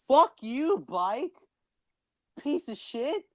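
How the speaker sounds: high, loud cursing at a bike; mock outrage. Host rants about loving to bike, mock outrage.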